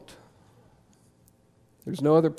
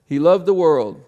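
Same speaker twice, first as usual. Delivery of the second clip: louder, offhand mock recitation of "He loved the world"; generic version; 2 semitones higher, 8 dB louder than usual. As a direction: toss off "He loved the world" loudly, with stress on "the". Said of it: "we genericize it".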